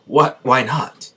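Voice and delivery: hurt disbelief. Acted. Subaru to Emilia after her rejection, hurt disbelief. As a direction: react as if hit hard, pitch jumping up. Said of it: "this hits Subaru very hard".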